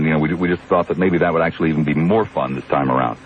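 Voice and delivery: usual speaking voice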